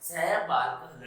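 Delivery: सह बालकः said slowly, each syllable clear; slow Sanskrit demo. Shown as a demonstration. Teacher modelling Sanskrit for the children, slow and clear. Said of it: "Sanskrit में".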